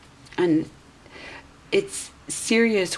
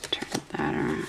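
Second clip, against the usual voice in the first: whispered aside; 7 dB quieter than usual. Real, not acted. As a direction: whisper "Turn that around." to yourself. Repeats card message softly.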